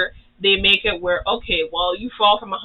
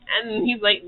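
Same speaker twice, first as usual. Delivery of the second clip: usual speaking voice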